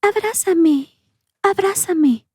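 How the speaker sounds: put-on eerie high-pitched baby plea, long drawn out; high ghostly child calling slowly; faint baby voice. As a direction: put on a thin, creepy infant voice. Ghostly little girl begs a hug.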